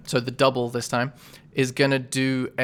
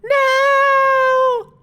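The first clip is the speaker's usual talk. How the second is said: very high, loud despairing shout; Flea's dying cry; 9 dB louder, much slower than usual. Acted. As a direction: shriek in panic as you fall. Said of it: "you hear Flea go".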